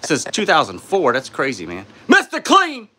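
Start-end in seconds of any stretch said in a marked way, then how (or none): 2.1-2.9 loud, high-pitched holler; shouted call-out. Real, not acted. Calls out loudly, then hurts throat.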